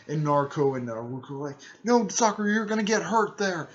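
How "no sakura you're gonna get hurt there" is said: high, loud warning cry; imitated urgent warning. Friends to girl running into a fight, raised warning. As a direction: call out to stop a friend.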